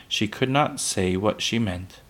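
ordinary delivery, nothing marked

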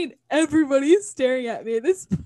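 normal talking voice

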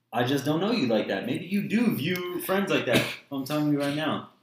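normal talking voice

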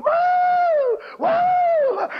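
high held cry of woo; eagle crying out. Retold or imitated. Preacher imitating the little eagle thanking God, high wailing woo. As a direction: wail it high like a weeping bird. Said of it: "He was going".

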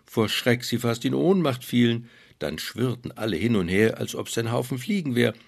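ordinary delivery

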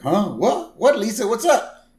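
startled, high and loud; acted jolt out of sleep, calling back; Homer. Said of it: "And Homer's like".

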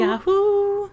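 high, stretched yodel cry; Yahoo yodel. Acted. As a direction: echo the Yahoo call back.

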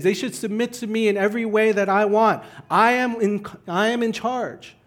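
high, mounting demands of a control freak; domineering insistence. Acted. Acting out the controlling, angry self.

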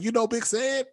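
very high-pitched incredulous quote; amazed question. Retold or imitated. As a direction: imitate astonished partygoers asking. Partygoers amazed he knows Ced.

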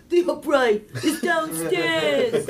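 slow, high, laughing grandpa impression. Imitating her grandpa for the hosts, slow and laughing. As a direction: drag out the words like an old grandpa, half laughing. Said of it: "My grandpa's like".

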